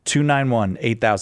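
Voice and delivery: ordinary talk, nothing marked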